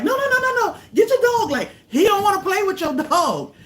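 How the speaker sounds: high, loud panicked shout; frantic yelling. Retold or imitated. A strange dog runs at Thor, frantic high yell. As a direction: yell at the neighbor to grab her dog. Dog running toward her dog.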